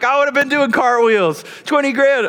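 high, loud-ish excited exclaiming; Matt. Retold or imitated. Pastor friend saying how he'd react, high excited exclamation. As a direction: imitate a buddy beside himself over the money.

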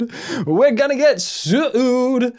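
squeaky, slow panicked wail; high-pitched comic panic; high squeal. Acted.

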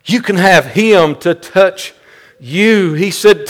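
usual speaking voice

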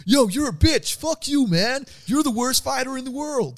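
high-pitched hammed-up trash talker; mock an aggressive fighter, squeaky and loud; over-the-top high trash talk voice. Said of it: "Whereas other people are like".

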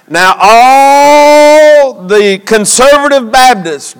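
emphatic, drawn-out scripture delivery; preaching. Real, not acted. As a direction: stretch the key words for the congregation.

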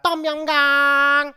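involuntary shout of Pad Thai; sudden outburst. Acted. Involuntary dish-name outburst.

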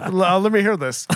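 normal talking voice

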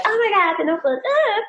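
hammed-up fangirl gushing over the film; mock gushing. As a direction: gush like a starstruck fan. Women gushing over romance movies.

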